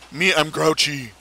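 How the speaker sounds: slow grunting caveman; a hint of a grouchy caveman; grumpy caveman voice. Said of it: "I gripe".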